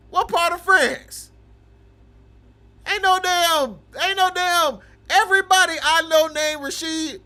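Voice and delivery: loud, high, mocking outburst; roasting yell. Real, not acted. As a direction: shout him down, laughing at the claim. Streamer roasting chatter loudly.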